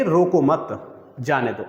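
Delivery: रोको मत said together, a short break, then जाने दो; group रोको with मत and pause before जाने दो; pause after मत. Shown as a demonstration. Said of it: "हम यहाँ पे आके रुके". (रोको मत, विराम, जाने दो)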